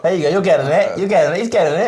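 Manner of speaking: high, bright cheering on; encouraging cheer. Real, not acted. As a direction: cheer him on excitedly.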